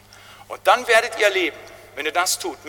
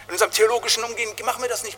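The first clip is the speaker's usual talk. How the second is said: ordinary delivery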